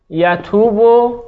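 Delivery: یتوبو stretched out, each syllable slow and distinct; teacher dictating یتوبو very slowly; slow dictation. Shown as a demonstration. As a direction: draw یتوبو out slowly so the class can write it. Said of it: "آها،".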